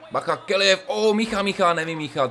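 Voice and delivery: high, loud startled outburst; excited alarm. Real, not acted. Gamer reacting to a near miss, high excited outburst. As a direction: jump up in pitch at the chance. Dangerous chance in game.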